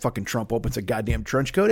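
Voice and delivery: normal talking voice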